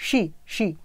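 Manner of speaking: she with a soft श, not स; sh sound. Shown as a demonstration. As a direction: start she with a hushed श sound. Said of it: "ये वाला sound निकलना चाहिए". (she को श की आवाज़ से बोलो)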